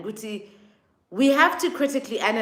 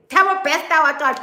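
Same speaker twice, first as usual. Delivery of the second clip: mock hype; 7 semitones higher, 5 dB louder than usual. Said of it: "Media will post something".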